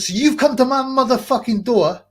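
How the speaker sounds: half-shouting at the census worker; angrily telling off the woman at the door; retold yelling.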